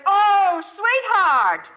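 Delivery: high, loud cry of delighted recognition; imitating an overjoyed sweetheart on the phone; delighted greeting. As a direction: exclaim it joyfully, like a loved one recognizing the caller. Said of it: "And the voice says".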